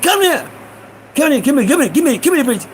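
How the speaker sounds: high, rapid-fire summons; angry Iranian shopkeeper. Retold or imitated. Shopkeeper summons boy each time he hits the door, imitated high and insistent. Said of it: "it was the Iranian motherfucker".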